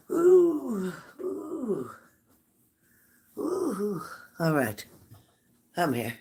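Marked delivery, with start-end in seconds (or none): 0.1-1.9 sharp high ooh of pain, then fading; high pained groans. Real, not acted. Streamer straightening up after bending over, pained high ooh. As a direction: groan as your back protests the bending.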